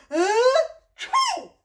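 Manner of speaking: loud, high "ah-choo"; pretend sneeze. Shown as a demonstration. Sneezing together with the children, pretend sneeze. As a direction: build up to a big mock sneeze. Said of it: "gonna pretend to sneeze together".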